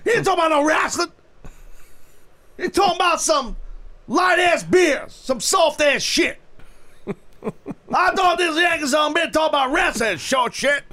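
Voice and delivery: high, hollered mock Texas accent; wandering Texas accent at a shouting pitch. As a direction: rant like a hammed-up Texan. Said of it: "My Texas accent rolls into all of those accents".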